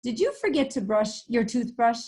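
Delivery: high, sharp mother's voice. Acted. Mother questioning Froggy, voice pitched high. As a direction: play the mother calling out.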